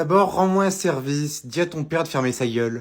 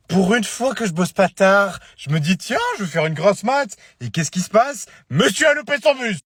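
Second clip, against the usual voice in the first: loud, high sarcastic tirade; exasperated scolding; 6 semitones higher, 6 dB louder than usual. Acted. Parent whose child missed the bus, exasperated. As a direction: rant indignantly, climbing to the last words. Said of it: "Je te jure, tu m'énerves".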